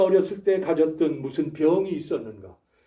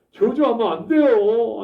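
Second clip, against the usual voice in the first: ordinary delivery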